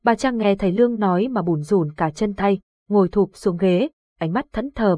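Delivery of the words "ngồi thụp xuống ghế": ordinary delivery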